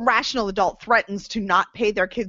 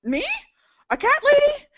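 shocked disbelief. Acted. Tina horrified at cat-lady future.

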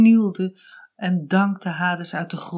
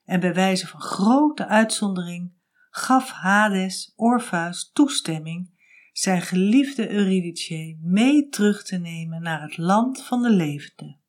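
plain reading voice

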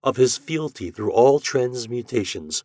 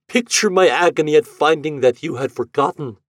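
cry; 5 semitones higher, 6 dB louder than usual. A narrator's reading. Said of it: "cried he".

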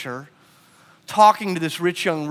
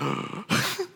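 shocked gasp; 13 semitones higher, 6 dB quieter than usual. Acted. Listeners' shocked reaction to Jesus.

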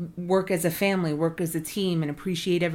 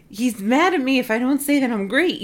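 higher and louder, half-joking as if yelled at; mock intimidated whine; 8 semitones higher, 6 dB louder than usual. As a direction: play the cowed listener of a shouting speaker. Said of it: "but super yelling in your face".

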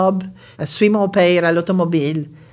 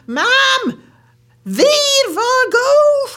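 urgent shout; 4 dB louder than usual. Retold or imitated. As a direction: yell at mom to turn. Brother shouting at mother. (cri pressant, aigu et fort)

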